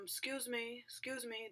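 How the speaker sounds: soft, polite call for attention; polite, tentative. Retold or imitated. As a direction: quietly try to catch the clerk's attention. Politely seeking booth clerk's attention.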